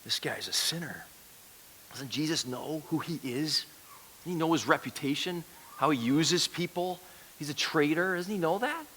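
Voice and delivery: hushed, disapproving murmur; soft gossipy grumbling; grumbling onlookers. Acted. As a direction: complain under your breath. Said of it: "they're grumbling and complaining".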